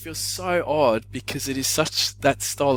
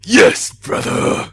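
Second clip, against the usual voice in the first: loud, high wrestler growl; Hulk voice; 13 dB louder, much slower than usual. Retold or imitated. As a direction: bellow it like a pumped-up wrestler. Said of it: "We good, Hulk?".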